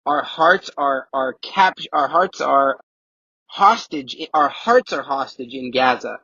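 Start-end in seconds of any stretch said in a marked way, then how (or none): none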